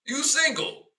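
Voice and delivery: high, loud taunting; heated put-down. Retold or imitated. Telling the woman she's single now, loud taunt. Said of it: "I'm like".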